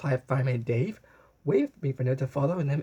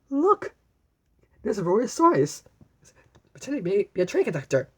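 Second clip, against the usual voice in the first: excited; 7 semitones higher, 4 dB louder than usual. Acted. Excited pointing out.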